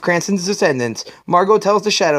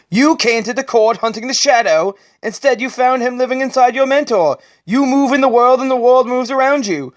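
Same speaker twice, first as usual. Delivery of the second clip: raised, otherworldly voice of a hidden stranger; mysterious voice; 7 semitones higher than usual. Acted. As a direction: speak from the shadows, higher and eerie. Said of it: "a voice says to Bruce".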